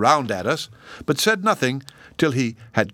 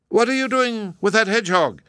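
shouting; 12 semitones higher, 3 dB louder than usual. A narrator's reading. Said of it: "he shouted at the gardener".

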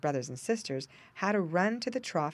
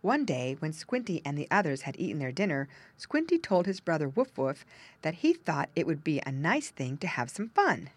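plain reading voice

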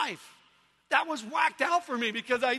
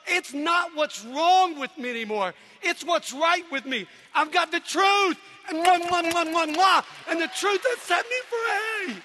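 raised, loud retort building to a high, drawn-out cry; defiant exultation; 4 semitones higher, 4 dB louder, slower than usual. Acted. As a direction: proclaim it triumphantly at the critics. Acted defiant retort to older critics.

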